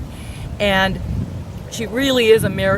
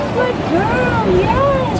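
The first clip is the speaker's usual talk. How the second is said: squeaky praising a dog; 12 semitones higher, much slower than usual. Real, not acted.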